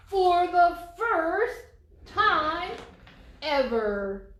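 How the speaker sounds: loud, slow, marvelling build-up; dramatic reveal. A narrator's reading. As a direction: stretch it out like a big surprise. Story climax, dog smiles.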